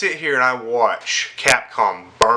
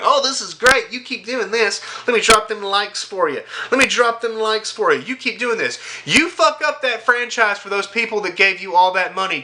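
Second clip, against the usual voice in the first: high, sneering fanboy voice, lifted well up; mocking fake fans; 7 semitones higher than usual. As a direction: ham up a dim, gushing fanboy. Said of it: "troglodyte fake fans come in going".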